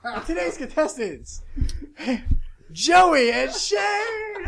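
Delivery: high, loud, slow announcer call; game show announcer. Pretend. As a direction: announce the contestants like TV.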